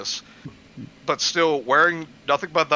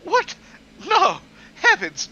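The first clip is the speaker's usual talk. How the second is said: much higher, louder shocked cry; shrieking mock mom; 5 dB louder than usual. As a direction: gasp it like a scandalised mother. Mom character shocked, mock high.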